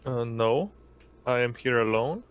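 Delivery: normal talking voice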